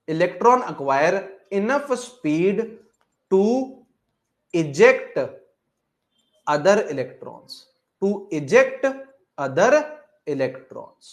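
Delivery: each word drawn out slowly for notes; slow classroom dictation. Shown as a demonstration. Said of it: "teacher dictating slowly".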